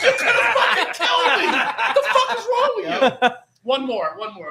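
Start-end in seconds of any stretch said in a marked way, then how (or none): 0.0-3.4 high, loud yell through laughter; incredulous, hammed-up hollering; outraged shout. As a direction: re-enact screaming at dad, voice shooting up. Replays outraged protest to dad.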